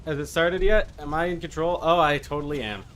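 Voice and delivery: normal talking voice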